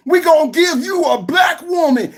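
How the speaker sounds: mock party boss yell; mock shout. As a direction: shout it like a smug party boss selling a deal. Mocking the party's pitch.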